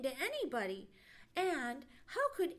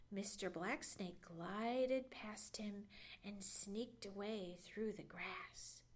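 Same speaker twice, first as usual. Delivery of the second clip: whispering; 4 semitones lower, 6 dB quieter than usual. A narrator's reading. Whispered bedtime reading.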